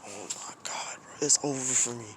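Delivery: breathy whispered panic, almost no voice; panicked whisper. Retold or imitated. Student whispering his dread in the office. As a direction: whisper it like the world is ending. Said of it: "in my mind, bro, I'm just like".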